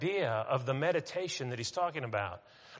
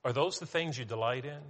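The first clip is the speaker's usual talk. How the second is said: ordinary talk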